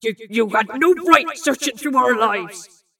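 loud, stumbling indignation; stammering. A narrator's reading. Bill confronts Lorelei over searching their lives, indignant stammer. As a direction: stammer at the start, then push out the outrage. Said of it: "Bill stammered".

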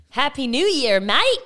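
loud, hammed-up Australian greeting; Australian accent. Shown as a demonstration. Host doing New Year around the world, Australian accent. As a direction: put on a broad Australian accent, lifting on mate. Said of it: "Let me do Australian".

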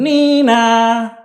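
high loud nee-naw; siren imitation. A narrator's reading. Police arriving at the farm, loud siren call.

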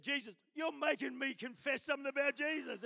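high, loud mock outburst; loud protest. Nurse protesting to the preacher coaxing her, loud outburst.

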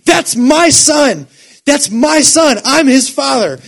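slow, loud, high cry of a father in the stands; a father yelling from the stands to reach his injured son; retold desperate shout. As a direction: shout it over the crowd, claiming your son. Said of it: "the man starts shouting".

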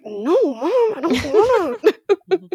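high, drawn-out protest to mom; mock childish refusal; whiny son. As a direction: play a reluctant kid, half laughing. Acted son protesting to mother.

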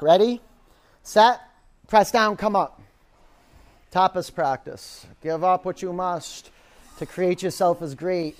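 loud, driving yoga class cues; coaching call. Acted. Instructor driving a yoga class hard. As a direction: push the room through the pose.

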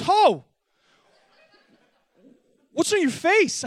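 high, loud alarmed cry at the swollen face; startled shriek. Retold or imitated. Wife waking up, shocked at his eye, shrieking. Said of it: "She goes".

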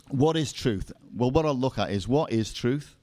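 ordinary talk, nothing marked

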